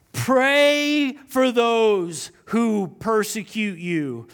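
very slow, loud and drawn out; stretched, weighty scripture reading; read really slow. Shown as a demonstration. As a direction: let every word land for slow absorbers. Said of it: "I'm gonna read this really slow".

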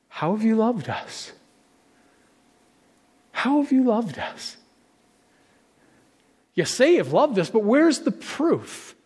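acted high-pitched, incredulous questioning; mock a sceptical people demanding proof; doubting challenge. Acts out the people's doubting response.

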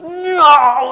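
put-on child's angry no. Grandson snaps at grandfather checking his leg, furious shout. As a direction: shout it like an upset grandson refusing help. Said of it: "he gets embarrassed and goes".

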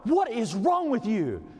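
hammed-up exasperation; retold upset. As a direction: play up the upset, dragging out each word. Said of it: "I'm so upset".

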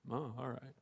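grudging calm. Retold or imitated. Angry man calmed down.

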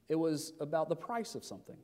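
ordinary talk

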